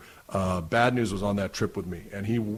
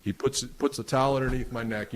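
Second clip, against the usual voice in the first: usual speaking voice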